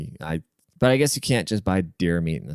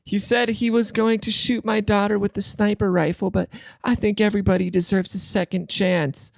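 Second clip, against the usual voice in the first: high airheaded mock voice; mocking the naive woman; 11 semitones higher than usual. Podcaster mocking the show's forgiving woman, high airheaded voice. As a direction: play her high and clueless.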